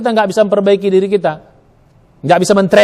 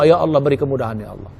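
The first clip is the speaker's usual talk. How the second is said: contoh doa yang makin pelan dan rendah sampai berbisik; doa lirih; 7 semitones lower, 6 dB quieter, faster than usual. Shown as a demonstration. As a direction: berdoa pelan, memudar di akhir. Mencontohkan doa dengan lirih. (doa lirih memudar)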